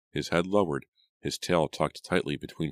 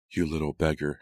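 plain reading voice

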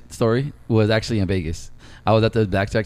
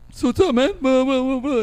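much higher, eager small talk; chatty tourist; 14 semitones higher than usual. Retold or imitated. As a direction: babble at the taxi driver. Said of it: "don't know how to shut up".

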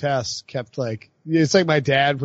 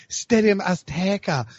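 stadium name with a Spanish flourish; mock gushing with a Spanish accent on the name; 3 semitones higher, slower than usual. Host mimicking a colleague's excited tweets, Spanish accent. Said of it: "Spanish accent".

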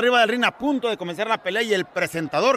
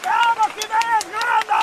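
cheering fan; 9 semitones higher, much slower than usual. Acted.

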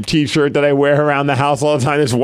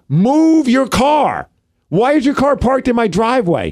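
each word hammered out in fury; angry yell; 8 semitones higher than usual. Retold or imitated. Homeowner yelling at a stranger parked in his driveway, slow angry shout. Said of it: "gonna keep yelling at the guy".

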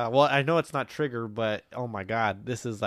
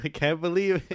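laughing imitation; 5 semitones higher than usual. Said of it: "You gonna say it again".

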